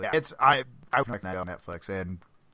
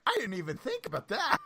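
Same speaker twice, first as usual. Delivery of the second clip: higher, clueless coach voice; dopey coach; 9 semitones higher than usual. Retold or imitated. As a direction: sound totally clueless. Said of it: "And he's like".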